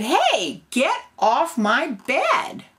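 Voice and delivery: high, loud, slow cub shout; angry baby bear. Acted. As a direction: yell like a furious little cub. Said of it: "the baby bear was mad".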